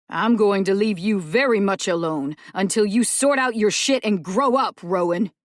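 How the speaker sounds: loud, cutting parting shot; bitter shout. Acted. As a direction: spit it out on the way out. Dell storming out angrily.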